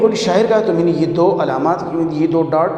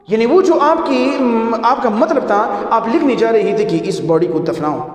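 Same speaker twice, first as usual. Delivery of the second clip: ordinary talk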